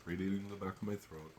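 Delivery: ordinary talk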